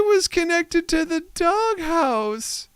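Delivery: put-on high, slow child voice; imitating Natalie. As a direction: mimic a worried little girl explaining. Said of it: "She goes".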